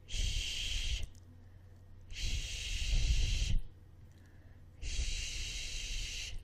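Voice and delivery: long unvoiced sh hiss; sh sound. Shown as a demonstration. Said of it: "Your voice box is turned off".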